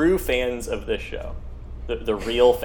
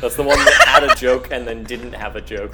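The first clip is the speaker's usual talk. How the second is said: unimpressed; 7 dB louder than usual. Real, not acted. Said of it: "You sound so unimpressed".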